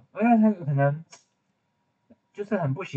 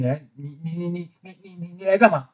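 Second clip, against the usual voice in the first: mock annoyed stammer; 4 semitones higher than usual. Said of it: "他一定很不爽".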